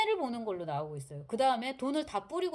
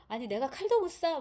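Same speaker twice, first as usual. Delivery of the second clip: imitated indignant outburst, higher and louder; flaring up; 6 semitones higher, 6 dB louder than usual. Imitating the actor's Rogozhin flaring up over a knife. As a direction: protest hotly, pitch jumping up. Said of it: "로고진이 버럭하는 장면도".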